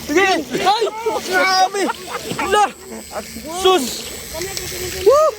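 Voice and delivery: high-pitched amazed exclamations; real excited yell. Real, not acted. Angler amazed by the catch, excited shouting. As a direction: cry out and whoop at the catch. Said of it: "Pati kami".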